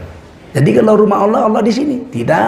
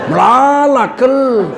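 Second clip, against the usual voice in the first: higher Karo "melala, kel" through laughter; laughing Karo-style exclamation; 4 semitones higher, much slower than usual. Retold or imitated. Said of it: "kalau orang Karo pakai kel".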